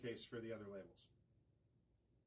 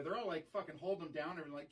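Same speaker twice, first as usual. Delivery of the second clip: ordinary talk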